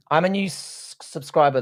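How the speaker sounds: usual speaking voice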